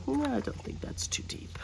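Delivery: whispered, answering her own question; hushed aside to herself. Real, not acted. Crafter reassuring herself about the pocket depth, whispering.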